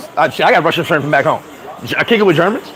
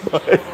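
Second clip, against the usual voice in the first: silent laugh; 3 dB quieter than usual. Real, not acted.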